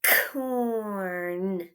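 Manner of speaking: corn stretched with a clap; clapped word. Shown as a demonstration. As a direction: clap along as you say it. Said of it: "Put your hands together".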